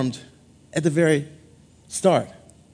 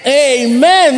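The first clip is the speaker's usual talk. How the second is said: call for amen; 3 semitones higher, 7 dB louder than usual. Real, not acted. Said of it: "all my married couples say".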